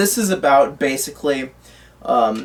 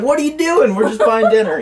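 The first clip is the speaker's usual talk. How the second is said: much higher-pitched, a bit louder indignant quip; mock character quote; 7 semitones higher, 4 dB louder than usual. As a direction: squeak it out as a jokey impression of the character. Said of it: "and he's like".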